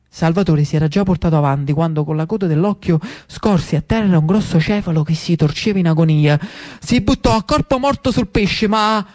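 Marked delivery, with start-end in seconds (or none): none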